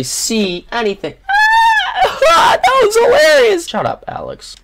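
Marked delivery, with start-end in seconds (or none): 1.2-2.3 loud, very high shrieking laugh; screaming laugh. Real, not acted. Bursts out at game moment. 2.3-3.7 very high, loud laughing squeal; shrieking with laughter. Real, not acted.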